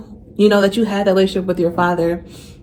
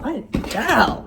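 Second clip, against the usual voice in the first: choked up echo; 4 semitones higher, 6 dB quieter than usual. Retold or imitated. She echoes in disbelief.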